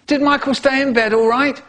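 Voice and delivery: high, loud call through a door. Acted. Dad calling through the door, loud and high. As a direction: call out brightly to the house. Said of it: "My dad calls out".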